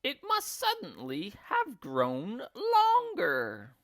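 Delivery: high, slow crone voice; baffled old woman, drawn out; Missus Twit. A narrator's reading. Wife guessing about her stick in a high slow voice. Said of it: "Missus Twit said".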